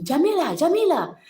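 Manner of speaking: lightly voiced boy calling out with a falling tune; child calling. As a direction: call the name twice as a child would. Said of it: "who come and ask me".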